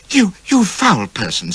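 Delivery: high, slow, sputtering indignation; indignant. A narrator's reading. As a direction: stammer out offended outrage. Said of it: "says she in indignation".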